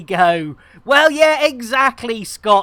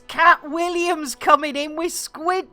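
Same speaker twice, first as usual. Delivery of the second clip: excited welcome; 7 semitones higher than usual. Acted. Greeting new bar patron.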